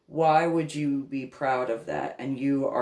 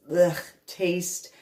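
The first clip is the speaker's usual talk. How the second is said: usual speaking voice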